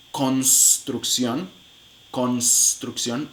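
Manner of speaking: construcción drawn out slowly, syllable by syllable; slow syllable demo. Shown as a demonstration. A teacher slowly spells out the sounds of construcción. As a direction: stretch out construcción slowly to show the ns.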